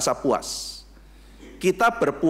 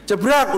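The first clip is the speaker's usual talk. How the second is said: loud, higher crash sound jebrak; crash noise jebrak; 6 semitones higher, 6 dB louder than usual. Retold or imitated. Preacher telling a car crash story, loud crash noise jebrak. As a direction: bark out jebrak like a sudden collision.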